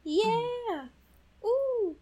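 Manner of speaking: high excited whoop; cheering. Real, not acted.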